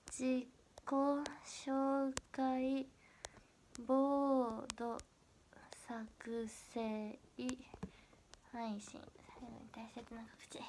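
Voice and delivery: drawn out syllable by syllable, fading to a murmur; to yourself while typing, slow then very soft; typing aloud. Real, not acted. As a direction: read it out slowly as you type, trailing into near-whisper. Reads aloud while retyping a caption.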